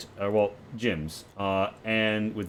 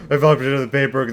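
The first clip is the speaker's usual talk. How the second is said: higher, louder, fast sobbing cry; louder sobbing repeat; 4 semitones higher, 8 dB louder, faster than usual. Acted. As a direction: repeat it harder, almost wailing. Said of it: "but he's sobbing".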